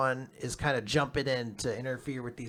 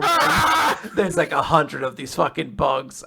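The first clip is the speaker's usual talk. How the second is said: laughing through the words; can't hold back a laugh at the absurdity; amused, half-laughing description; 3 semitones higher, 6 dB louder than usual. Real, not acted. Laughs while describing bugs.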